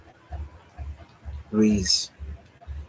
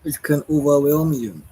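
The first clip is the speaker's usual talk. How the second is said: words stretched through a real yawn; 6 semitones higher, 6 dB louder than usual. Real, not acted. Late-night talker to her audience, yawning through the line. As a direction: say it mid-yawn, sleepy and late. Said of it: "Sorry, I'm yawning".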